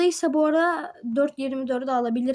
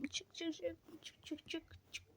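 whispered urging; 3 semitones higher, 25 dB quieter than usual. Real, not acted. Urging character up a ledge.

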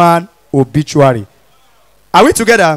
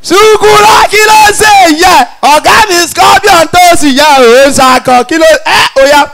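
high, loud shout across the street; rally the boys with a yell; shouting to friends; 8 semitones higher, 5 dB louder than usual. Acted. Calling friends to join.

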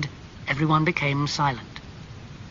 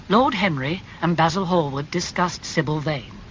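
ordinary delivery

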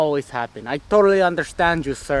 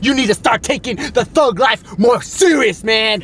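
shout; 5 semitones higher, 4 dB louder than usual. Acted. Scolding crying gang friend in car.

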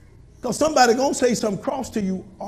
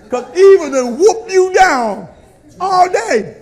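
louder, slower, driving each word; loud preaching; 8 semitones higher, 9 dB louder, slower than usual. Acted. Pastor to congregation, booming slow emphasis. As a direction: boom it out to the pews. Same line, quiet then loud.

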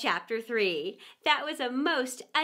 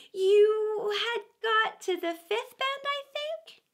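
high, slow, fussy mouse; a prim mouse, rather put out; 8 semitones higher, 3 dB louder, slower than usual. Acted. Mouse continuing its tale.

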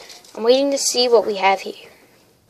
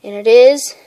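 ordinary talk, nothing marked

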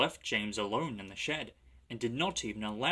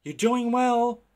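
high, slow, loud whistle-like call; whistling; 12 semitones higher, 9 dB louder, slower than usual. A narrator's reading. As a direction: sing it out like a train whistle. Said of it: "whistled Edward".

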